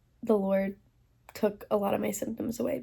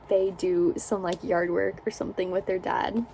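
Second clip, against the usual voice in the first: ordinary talk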